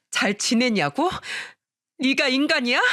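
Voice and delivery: high, indignant acted outburst; outraged yelling. A narrator's reading. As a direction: rise from bitter echo to accusation. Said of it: "제가 악을 쓰면서 소리치자". (높은 목소리로 분해서 따지기)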